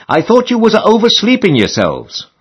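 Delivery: a hint of a fussy landlady calling through the keyhole.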